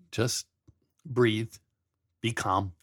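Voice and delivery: soft, reassuring; calming. Real, not acted. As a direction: gently soothe them.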